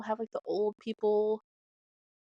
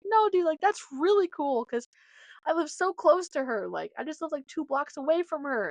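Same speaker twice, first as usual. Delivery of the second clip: excited insisting; 7 semitones higher than usual. Retold or imitated. Insisting to mom it's cool.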